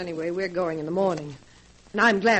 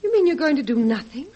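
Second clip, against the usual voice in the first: appalled disbelief; 5 semitones higher, 7 dB louder than usual. Acted. Guest appalled at his plan.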